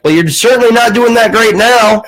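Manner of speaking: high, indignant mock-Southern caller; hammed-up Southern put-down; Southern accent. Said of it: "he had a southern accent".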